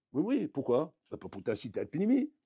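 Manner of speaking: ordinary delivery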